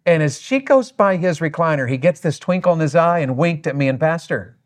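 ordinary talk

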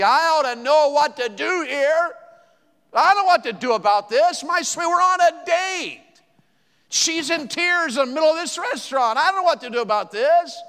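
high, loud, hammed-up panic; exasperated helplessness. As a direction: wail it out as a flustered husband. Acts out helpless panic on the date.

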